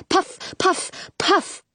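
sharp high puff sounds; popping puffs. A narrator's reading. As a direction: pop each puff out. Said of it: "they sound like fireworks".

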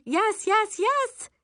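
very high, rapid-fire eager yeses; impatient agreement. A narrator's reading. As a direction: squeal yes to get it over with. Impatient girl agreeing to mother's rules.

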